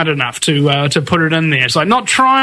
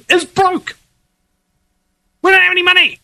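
exasperated outburst; 10 semitones higher than usual. Real, not acted. Exasperated outburst about being broke.

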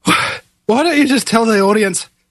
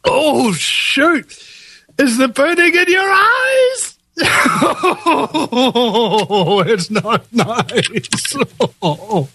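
laughing in pain; 5 semitones higher, much slower than usual. Real, not acted. Chili burning, laughing in pain.

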